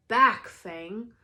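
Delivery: loud command to the dog; Hagrid command. Acted. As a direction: bark it at the hound.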